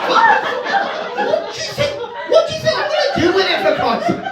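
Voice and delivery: high, indignant, fast exasperated rant; outraged disbelief. Retold or imitated. Tourist ranting at the shopkeeper's silly warning, high outraged disbelief. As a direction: squeal in outrage at the absurd question.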